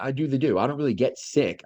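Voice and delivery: ordinary talk, nothing marked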